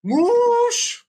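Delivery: very high, long drawn-out shriek; mock scream. Family spotting a mouse in the new house, mock scream. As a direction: squeal the word out in mock panic.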